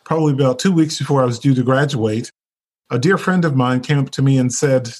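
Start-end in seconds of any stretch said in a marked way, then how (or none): none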